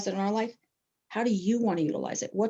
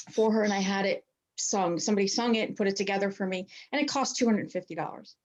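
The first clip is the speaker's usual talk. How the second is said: usual speaking voice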